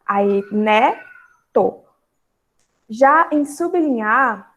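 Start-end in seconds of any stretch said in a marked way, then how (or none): 0.5-1.8 "né" and "to" split by a clear pause; syllable division. Shown as a demonstration. Teacher finishes dividing bisneto, syllable by syllable. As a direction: say "né, to" as two separate syllables. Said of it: "a separação vai ficar".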